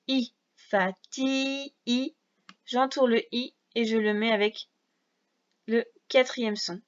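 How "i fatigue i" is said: "fatigue" drawn out very slow to pick out the I; sounding out slowly. Shown as a demonstration. Teacher isolating a sound for a pupil, drawn out slowly. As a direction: stretch "fatigue" so the I sound stands out.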